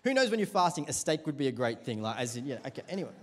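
ordinary talk

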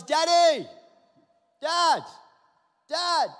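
high, loud repeated calls; child yelling. Retold or imitated. Daughter yelling for her father at night, loud high calls. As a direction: yell Daddy like a little girl from bed.

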